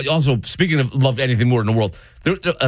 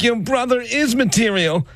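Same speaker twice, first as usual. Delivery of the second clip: mom voice pitched way up; mocking mom. Teasing mom's high voice to her son. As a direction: mimic mom, high and sing-song. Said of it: "My mom's like".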